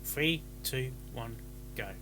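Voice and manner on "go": usual speaking voice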